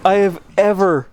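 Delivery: laughing disbelief; giddy relief. Real, not acted. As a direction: still buzzing from the escape. Relief after close run.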